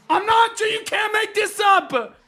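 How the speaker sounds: high, loud exclamation at how unreal it was; excited shout. Real, not acted. Streamer telling chat about China, high excited shout. Telling viewers a wild travel story.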